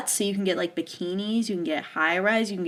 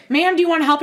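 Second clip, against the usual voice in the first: helpful staff voice; 8 semitones higher, 6 dB louder, faster than usual. Retold or imitated. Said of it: "They're like".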